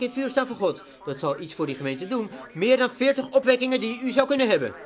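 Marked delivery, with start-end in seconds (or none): 2.5-4.7 loud, high preacher's outburst; fervent pulpit cry; emphatic shout. Acted. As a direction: hammer the point home.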